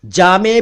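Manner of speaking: जामे ending in a long e; variant pronunciation. Shown as a demonstration. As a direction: say जामे as "jaa-may". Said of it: "इसके कई उच्चारण हैं". (जामे का उच्चारण, अंत में ए)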